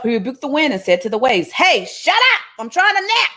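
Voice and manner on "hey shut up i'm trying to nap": high, irritated just-woke-up snap; grumpy woken Jesus. Acted. Jesus rebuking the storm after being woken, irritated. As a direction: bark it like someone roused from a nap.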